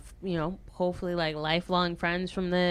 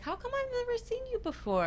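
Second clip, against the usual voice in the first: high quoted voice; 8 dB quieter, faster than usual. Retold or imitated. Quoting the other violinist.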